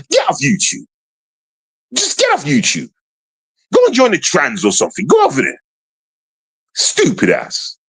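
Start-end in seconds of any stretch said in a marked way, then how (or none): 0.1-5.1 angry dismissal. Real, not acted. Ranting at YouTubers.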